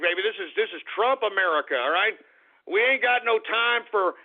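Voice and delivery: ordinary delivery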